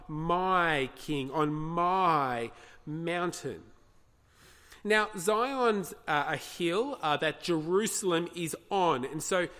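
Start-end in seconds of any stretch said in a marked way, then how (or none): 0.1-3.6 each "my" drawn out and punched; stress on "my". Shown as a demonstration. Preacher showing the verse's emphasis, "my" stressed. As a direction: hit "my" hard, slowing into the end. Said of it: "Did you notice the emphasis on the, on the my".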